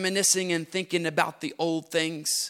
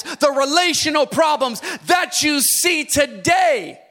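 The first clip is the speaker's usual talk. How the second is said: higher, louder building shout; preaching at full force; 8 semitones higher, 7 dB louder than usual. Acted. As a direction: build the list to a cry. Rising list at full force.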